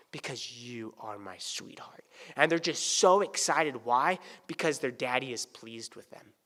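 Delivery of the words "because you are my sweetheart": tender whisper. Retold or imitated. Father to daughter, tender.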